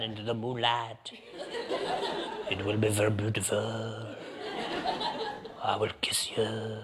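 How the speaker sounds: put-on low, languid French accent; cool Parisian accent. Parisian at last orders inviting a kiss, French accent. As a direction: play a Parisian seducer, sinking slowly to a low murmur. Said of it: "In Paris, they're all cool".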